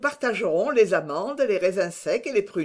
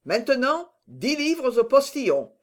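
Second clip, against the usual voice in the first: military tone of command, pitched up; crisp officer's order; 5 semitones higher than usual. A narrator's reading. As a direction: order it like drilling troops. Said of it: "d'un ton qui l'eût mis à commander une manœuvre".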